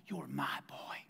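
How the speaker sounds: breathy hushed words to a son; soft whisper. Acted. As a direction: whisper it like a secret blessing. Pastor voicing God, whispered.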